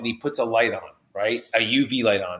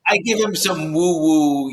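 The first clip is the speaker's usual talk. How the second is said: ordinary delivery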